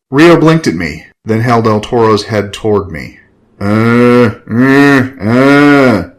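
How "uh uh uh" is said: loud zombie grunts; grunting. A narrator's reading. Zombie brother grunting at his sibling, loud grunts. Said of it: "he grunted".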